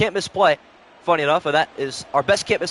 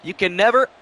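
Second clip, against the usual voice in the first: normal talking voice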